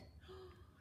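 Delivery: a breathy gasp in mock alarm; soft shocked gasp. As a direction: gasp as if catching him on the bed. Cat on the forbidden bed.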